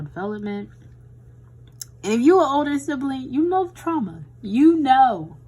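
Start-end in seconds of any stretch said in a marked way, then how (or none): 2.0-5.4 loud, high, fast and emphatic; excited. Real, not acted. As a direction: burst out knowingly about the trauma. Oldest-sibling trauma, raised voice.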